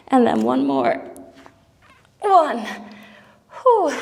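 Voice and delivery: straining through the last rep; shaking with effort. Real, not acted. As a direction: arms trembling, pushing out the words. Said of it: "My arms are shaking".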